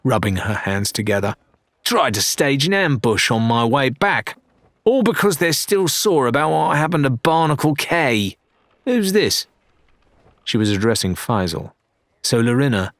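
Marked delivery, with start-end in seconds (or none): 1.8-9.5 a cocky pirate captain, scornful, then curious, higher; the captain brushes off the ambush and sizes up the stranger; Adelaide, captain. Acted. Captain to her first mate, cocky, pitched higher.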